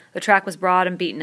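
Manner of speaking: ordinary delivery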